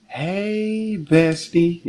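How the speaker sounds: high, drawn-out "hey"; high-pitched mimicked greeting; mock bestie greeting. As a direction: imitate an over-familiar friend saying hi. Imitating friend's flirty greeting.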